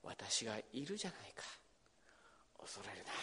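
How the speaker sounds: breathy whisper of "私だ"; a soft whisper of presence. Acted. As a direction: whisper it gently into the storm. Jesus's words whispered.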